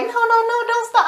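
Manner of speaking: very high hammed-up whine; mock pleading. Melissa begging Benny to continue, mock pleading. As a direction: imitate her begging, pitch way up.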